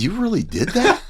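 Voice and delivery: "You really did that?" squeaked out high through a laugh; laughing disbelief. Retold or imitated. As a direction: laugh in amazement as you ask it.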